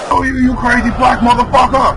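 startled yell. Real, not acted. Attacked by a monster in game.

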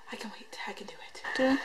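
hushed whisper to herself; breathy self-pep whisper. Real, not acted. Whispers to self about hunger.

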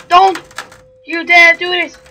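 loud high warning shout. Real, not acted. Player warning the ghost off, high loud shout. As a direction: yell it like a desperate threat.

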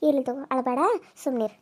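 put-on gently calm the crying girl down; soft consoling. Aunt hushes her crying niece, soft and soothing.

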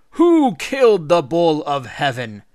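loud, slow, drawn-out call. A narrator's reading. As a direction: call it out to a crowd, stretching the words. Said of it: "he called out".

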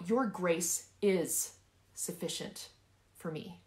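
drawn-out, insistent "is"; stress on IS. Shown as a demonstration. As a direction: stretch and hit "is", then ease off. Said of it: "I want you to emphasize each word".

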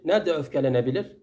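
ordinary talk

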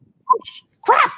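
sudden high "oh, crap"; surprised. Real, not acted. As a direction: caught off guard, alarmed. Realizing taping day is now.

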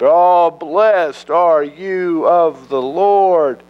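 high, slow, gushing flattery; buttering up. Acted. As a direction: lay the flattery on thick. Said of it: "really trying to butter the prophet up".

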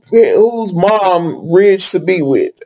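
slow, laughing through the words; amused laughter at the soap plot. Real, not acted. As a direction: giggle as you say it.

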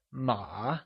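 "ma" softly dipped, then rising; falling-rising tone. Shown as a demonstration. Teacher gives the correct answer, "ma" in falling-rising tone. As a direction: let "ma" fall low and curl back up. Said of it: "falling rising tone".

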